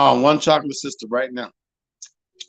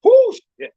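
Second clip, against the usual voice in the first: high yelp from the burning heat; pained yelp. Real, not acted. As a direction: squeal at the burning mouth. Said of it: "My mouth is on fire".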